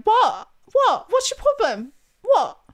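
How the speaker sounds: loud, high-pitched mock-British badgering; British accent. Needling Nathan who hates it, loud high British accent. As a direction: pester loudly in a hammed-up British accent. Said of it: "let me know how my British accent is".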